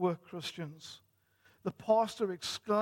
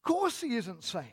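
high-pitched scoffing exclamation; incredulous retort; 10 semitones higher than usual. A narrator's reading. Mister Beaver answers Lucy about safety, incredulous. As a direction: exclaim it as if the idea were absurd.

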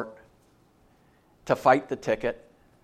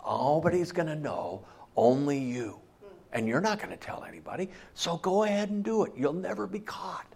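soft tempting voice; 9 dB quieter than usual. Acted. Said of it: "he whispers in your ear".

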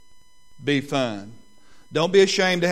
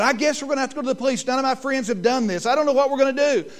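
higher, fast anxious son; worried son; 8 semitones higher, faster than usual. Retold or imitated. Pastor voicing his son about to call police, higher and rushed. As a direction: rush it like a panicking teen.

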